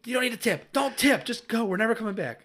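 high, loud panicked urging; hammed-up desperate shout to leave; frantic inner rant. Dad mentally urging wife out of the restaurant, frantic yell.

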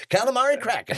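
high, loud pun reveal; excited punchline. Acted. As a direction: belt the pun out proudly.

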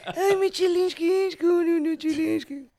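high, slow cooing pet voice; dog baby talk. Shown as a demonstration. Asked for her dog voice, she coos high and slow. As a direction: coo at your little dog as sweetly as you can. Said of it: "A ver, tu voz de perrito".

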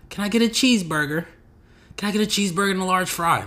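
high, careful put-on American order; mock posh American accent. Mocking Brits at a lunch table, high put-on American voice. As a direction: order a cheeseburger like a Brit faking American. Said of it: "very good American accents".

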